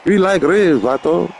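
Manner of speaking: put-on playful Chicano homeboy accent; mock Chicano street talk; Chicano vato.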